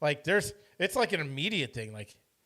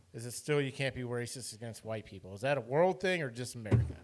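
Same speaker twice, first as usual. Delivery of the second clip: ordinary talk, nothing marked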